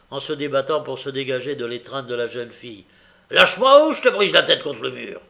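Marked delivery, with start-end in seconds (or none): 3.3-5.2 loud, raised-pitch threat; angry threat. A narrator's reading. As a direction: threaten her at full voice. Sykes threatens Nancy while struggling.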